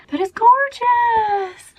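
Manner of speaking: high, slow, gasping with delight. Real, not acted. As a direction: swoon over the result. Gasping delight at own hair.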